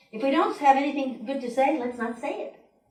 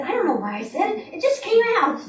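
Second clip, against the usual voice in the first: flustered admission, lighter; 9 semitones higher, 5 dB louder than usual. Retold or imitated. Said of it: "he said".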